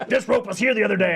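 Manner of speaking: high, quick, loud complaint; flustered Kaz. Acted. Kaz complaining to captain in stress dream, high and flustered. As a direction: protest fast and high. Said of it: "So she goes".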